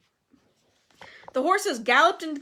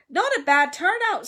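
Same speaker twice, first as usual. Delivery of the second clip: loud, raised, pleased boast; proud; 7 semitones higher, 7 dB louder than usual. A narrator's reading. Nick surveying his party guests, proud. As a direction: puff up like a satisfied host. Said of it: "said Nearly Headless Nick proudly".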